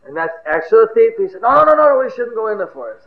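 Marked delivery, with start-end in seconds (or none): 1.4-3.0 high, loud burst, then easing; alarmed objection. Acted. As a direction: cut in urgently to stop them. Thief objects to the search.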